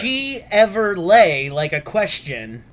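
very slow, drawn out; slow breakdown. Shown as a demonstration. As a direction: spell it out word by word. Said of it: "I'm gonna break it down even more".

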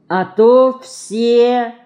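trailing, perplexed drawl; puzzled drawl. A narrator's reading. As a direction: stretch the words out in bewilderment. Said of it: "В недоумении протянула мышка".

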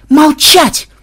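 high, loud command; furious yell. A narrator's reading. Programmer silencing an objecting officer, furious yell. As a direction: shout the officer down. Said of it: "заорал Ксенобайт".

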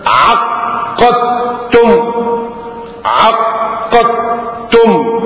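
slow, chanted recitation; Quran recitation. Acted. Preacher recites verse slowly to congregation. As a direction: intone the verse slowly and reverently.

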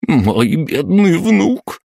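high, drawn-out grandmother's wail; wailing cry. A narrator's reading. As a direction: cry out in grief, stretching the words. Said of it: "вскрикнула толстушка и глухо зарыдала". (горестный протяжный вскрик бабушки)

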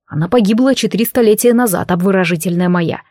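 plain reading voice

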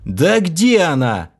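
slow, exasperated outburst; exploding. A narrator's reading. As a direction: burst out in frustration. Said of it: "взорвался я".

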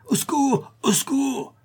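very high, strained yell; cry for help. A narrator's reading. Bailiff being beaten on the ground, crying for help. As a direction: yell for rescue in panic. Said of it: "Cria l'huissier".

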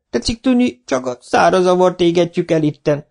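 high, mock-innocent old villager; innocent old man. Old Zsibora explaining to the foreman, mock-innocent. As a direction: play dumb, sweetly. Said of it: "mondta Zsibora bácsi csöndeskén".

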